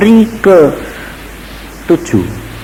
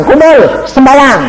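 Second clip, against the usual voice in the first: repeated command; 14 semitones higher, 4 dB louder, slower than usual. Retold or imitated.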